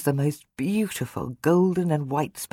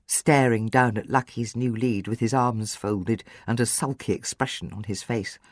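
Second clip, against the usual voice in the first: ordinary delivery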